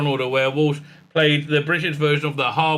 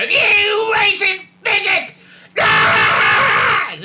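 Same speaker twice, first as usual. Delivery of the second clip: shrill hammed-up scream, much higher and louder; mock outraged shout; 7 dB louder, much slower than usual. Fans screaming at him in mock outrage. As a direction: screech it like an outraged online mob. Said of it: "you pricks turned around and go".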